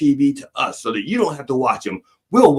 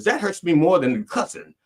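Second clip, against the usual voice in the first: ordinary talk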